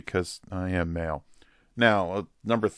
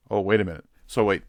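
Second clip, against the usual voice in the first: ordinary talk, nothing marked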